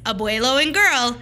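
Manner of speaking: plain reading voice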